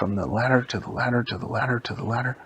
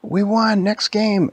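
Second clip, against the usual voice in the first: high, loud triumphant call; acted victory cheer; 9 semitones higher, 6 dB louder, slower than usual. The seed after beating the UFO, acted victory cheer. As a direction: cheer it out as a winning game hero. Celebrating a win.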